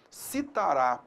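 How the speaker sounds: Citará with the final á stressed; stress on last syllable. Shown as a demonstration. Teacher gives an example of final stress, Citará stressed on its end. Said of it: "a tonicidade recai sobre a última sílaba".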